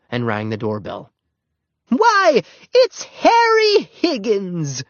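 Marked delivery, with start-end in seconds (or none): 1.9-4.8 high, loud, slow delighted exclaim. A narrator's reading. Missus Peabody opening her door to the paper boy, delighted. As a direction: greet him with big surprise. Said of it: "exclaimed Missus Peabody".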